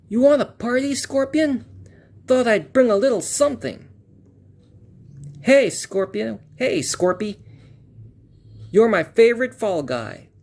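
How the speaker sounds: put-on high, cocky kid hero. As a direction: taunt him as a brash young hero. Hero lines from the comic.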